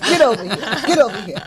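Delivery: high, loud stuttering call; scolding grandmother. Retold or imitated. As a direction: imitate grandma calling the kid over. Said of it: "my grandmother just went".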